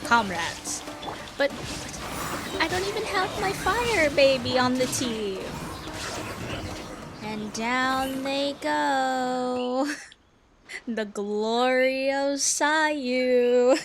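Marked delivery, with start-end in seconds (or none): none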